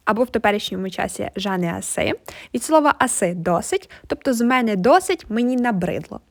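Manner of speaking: ordinary talk, nothing marked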